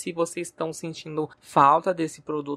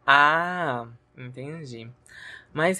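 normal talking voice

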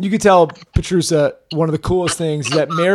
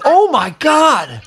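slow, high awed gasp; 8 semitones higher, slower than usual. Retold or imitated. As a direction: breathe it out in awe. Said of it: "We're like".

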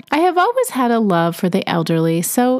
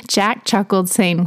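ordinary delivery

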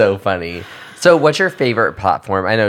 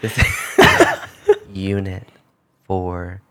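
sexy phone voice; 6 semitones higher, slower than usual. Pretend. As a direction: try to purr it, stifling giggles. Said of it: "You have to answer the phone".

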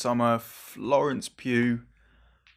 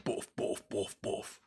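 1 heartbeat noise; 2 semitones lower, 9 dB quieter, slower than usual. Pretend. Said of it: "my heart was just going".

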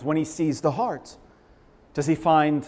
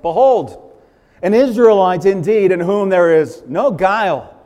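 loud, ringing declaration; Jesus hailing Nathanael, loud; proclaiming Jesus; 2 semitones higher, 11 dB louder than usual. Acted. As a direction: announce it to the crowd.

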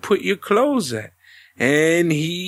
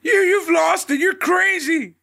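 mock yell; 10 semitones higher than usual. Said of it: "He's like".

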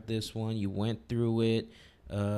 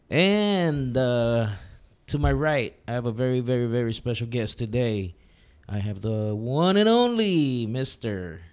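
grand entrance announcement; 6 dB louder than usual. Acted. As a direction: build it up for the guest. Introduces the special guest.

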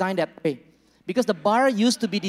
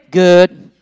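low, loud one-word reply; curt husband; 3 semitones lower, 18 dB louder than usual. Retold or imitated. Pastor voicing the husband answering his wife, loud and curt. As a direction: grunt it like a terse husband. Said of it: "You know".

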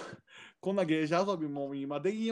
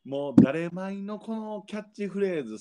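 ordinary talk, nothing marked

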